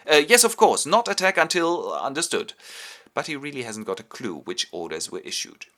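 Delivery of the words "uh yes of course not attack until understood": loud, higher hasty reply; flustered agreement. Retold or imitated. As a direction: agree as if you had been listening. Homburg hastily confirms, confused.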